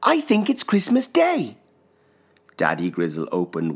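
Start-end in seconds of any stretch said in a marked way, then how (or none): 0.0-1.6 put-on child voice. A narrator's reading. Little Pip, eager cub.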